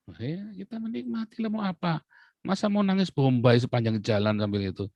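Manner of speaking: easygoing comeback; breezy retort. Retold or imitated. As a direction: shrug off the grief lightly. His reply while eating.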